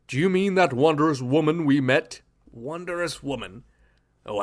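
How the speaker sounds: ordinary delivery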